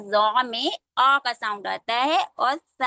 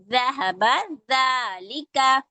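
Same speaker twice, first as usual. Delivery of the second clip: ज़हबा ज़ालिका drawn out slowly; slow recitation; 2 semitones higher, much slower than usual. Shown as a demonstration. Teacher showing letters joined in words. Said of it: "हरूफ मिला के कलिमा कोई पढ़ते हैं".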